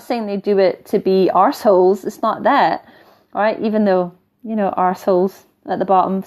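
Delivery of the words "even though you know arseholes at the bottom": ordinary delivery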